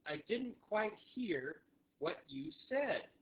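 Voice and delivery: phrase model. Shown as a demonstration. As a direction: model it slowly with a rise at the end. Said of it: "you can always say".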